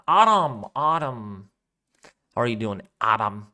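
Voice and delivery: Adam said as AH-dam, a touch of German accent; light German accent. Shown as a demonstration. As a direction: greet Adam with a playful German lilt. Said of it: "So if I say your name like that".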